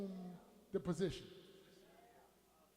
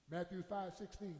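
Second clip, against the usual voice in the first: normal talking voice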